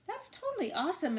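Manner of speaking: put-on high, fast boyish exclaim; excited shout. A narrator's reading. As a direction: blurt it out thrilled. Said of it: "Edison shouted".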